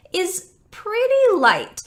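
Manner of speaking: light, said slowly; light airy H. Shown as a demonstration. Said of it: "the air that comes out of your mouth for the H".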